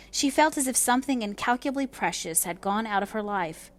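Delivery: ordinary delivery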